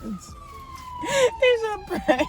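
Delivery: drawn-out sing-song reveal. Real, not acted.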